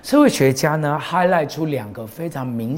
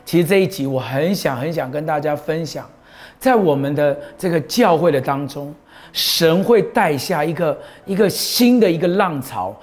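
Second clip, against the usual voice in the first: raised, ringing sermon voice; preaching; 4 semitones higher than usual. Acted. Pastor preaching to his congregation about losing the young, full-force sermon. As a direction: preach it out to the whole church.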